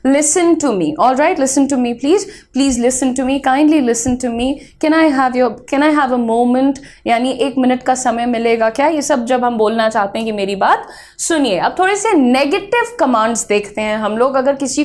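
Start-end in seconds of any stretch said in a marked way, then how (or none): none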